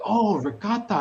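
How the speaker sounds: high, loud mocking French "Oh, ricotta"; mock French accent. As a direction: imitate the clerk's dismissive accent. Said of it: "And like".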